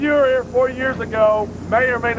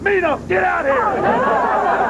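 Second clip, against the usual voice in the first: calling out; 9 semitones higher, much slower than usual. Retold or imitated. Summoning Minos to come out.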